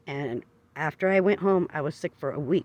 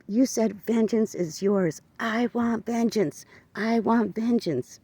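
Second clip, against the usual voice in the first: slow, higher, pleading demand; demanding vengeance; 6 semitones higher, much slower than usual. Retold or imitated. To God while driving, tearful slow demand for vengeance. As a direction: drag each word out, begging God for revenge. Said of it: "sort of kind of screaming at God".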